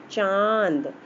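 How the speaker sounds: चांद slowly, the aa held through nose and throat; nasal vowel. Shown as a demonstration. Teacher demonstrates chandrabindu to children, slow nasal vowel. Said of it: "इसमें नाक और गले दोनों से आवाज़ निकल रही".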